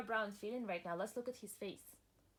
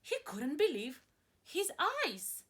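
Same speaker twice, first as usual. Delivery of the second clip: rising, louder astonishment; dramatic reading; 3 semitones higher, 7 dB louder, slower than usual. Acted. Teacher reading the farmer's shock, dramatic. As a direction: build it up, wide-eyed.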